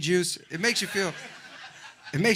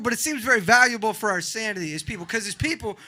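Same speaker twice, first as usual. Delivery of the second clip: ordinary talk, nothing marked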